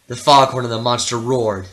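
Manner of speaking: ordinary delivery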